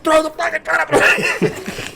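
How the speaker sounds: high laughing mock-angry order; half yell, half laugh; laughing mock rage.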